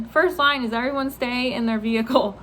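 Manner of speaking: ordinary delivery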